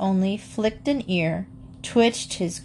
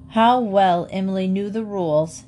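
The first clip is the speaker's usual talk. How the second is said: ordinary delivery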